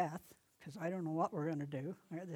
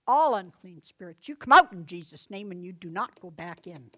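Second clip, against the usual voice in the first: much louder deliverance command; authoritative command; 15 dB louder than usual. Shown as a demonstration. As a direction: cast it out with full authority. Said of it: "you take your authority".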